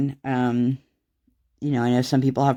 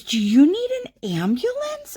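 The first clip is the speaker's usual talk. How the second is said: much higher, worried nurse voice; imitating the nurse. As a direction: mimic the anxious nurse offering help, way up high. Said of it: "And then she goes".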